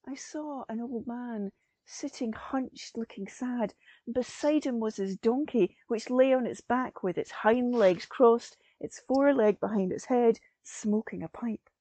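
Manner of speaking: ordinary delivery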